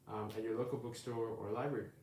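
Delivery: plain reading voice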